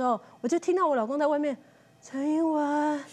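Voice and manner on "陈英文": slow, drawn-out ghost-film call of a name; ghostly call. Retold or imitated. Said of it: "这很像鬼片".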